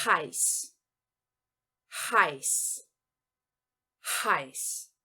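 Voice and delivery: heiß with breathy H, "ai", sharp s; German heiß. Shown as a demonstration. Teacher showing students the voiced H, German heiß. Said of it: "eu devo pronunciar o H".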